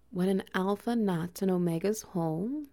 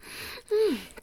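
pleased laugh; 12 semitones higher than usual. Acted. Pleased chuckle mid roleplay.

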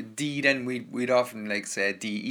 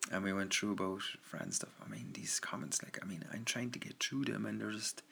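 breathy hushed whisper; 2 semitones lower, 11 dB quieter than usual. Real, not acted. Whispering to the chat late at night.

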